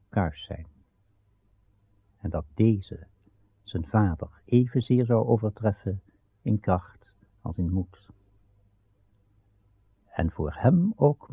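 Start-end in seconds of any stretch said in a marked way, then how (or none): none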